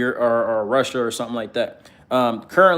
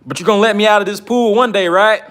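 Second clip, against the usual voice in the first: high, loud, hopeful plea; pleading underling; 8 semitones higher, 6 dB louder than usual. Acted. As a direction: plead high and loud, rising to an anxious check. Voicing hopeful blue-collar swimmer pleading.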